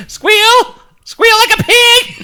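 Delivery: very high, loud squeal; hammed-up piercing squeal; squealed mock command. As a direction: shriek it like a squealing pig. Mock hillbilly movie-style call.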